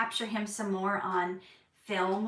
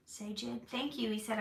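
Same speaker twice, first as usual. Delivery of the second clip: usual speaking voice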